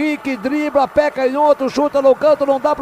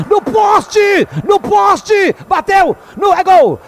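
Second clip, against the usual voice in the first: much higher, shouted goal call; explosive goal commentary; 5 semitones higher, 8 dB louder, much slower than usual. Real, not acted. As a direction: yell post, post, then scream gol. Narrator calls late winner.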